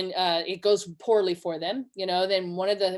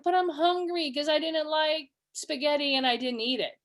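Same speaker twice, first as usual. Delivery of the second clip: higher-pitched mock whiny kid; child's complaint; 5 semitones higher than usual. Child who skipped dinner complaining to sitter, mock whine. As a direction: plead like a hungry child. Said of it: "So they are saying".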